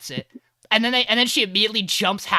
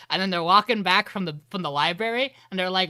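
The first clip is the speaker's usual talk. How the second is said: ordinary talk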